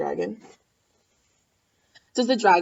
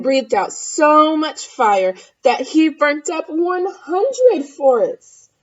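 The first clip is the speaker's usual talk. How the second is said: slower, louder, climbing to the peak; building drama; 5 semitones higher, 7 dB louder, slower than usual. A narrator's reading. Building fire narration.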